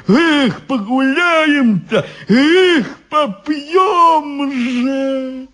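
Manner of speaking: high, long-held revelling cries; jubilant shouting. A narrator's reading. Guards look forward to a feast, jubilant. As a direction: whoop high and hold the last word. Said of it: "закричали они".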